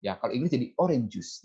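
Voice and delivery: ordinary talk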